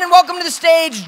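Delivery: ordinary talk, nothing marked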